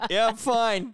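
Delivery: high, loud fake fine; sniffly denial. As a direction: insist you're fine. Crying man answers from shower.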